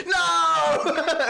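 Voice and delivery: very high, hammed-up wail; mock death cry. Orc shot in the face, crying out in mock agony. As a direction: wail it high like a doomed villain.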